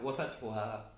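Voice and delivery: normal talking voice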